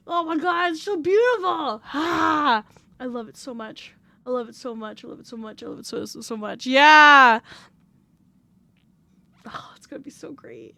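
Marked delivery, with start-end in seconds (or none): 3.0-6.6 hushed gushing. Real, not acted. 9.5-10.7 soft excited whisper. Real, not acted. Streamer whispering about new model, excited.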